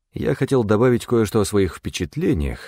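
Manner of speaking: plain reading voice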